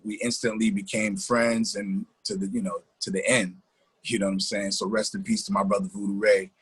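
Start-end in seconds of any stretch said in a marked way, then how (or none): none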